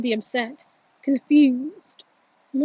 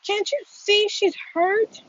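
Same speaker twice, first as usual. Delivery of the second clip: desperate plea; 9 semitones higher than usual. Acted. Pleading with laughing bullies.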